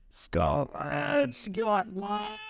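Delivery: mock Scottish accent, high and stretched; a playful stab at a Scottish burr. Joking to a friend in a mock Scottish accent.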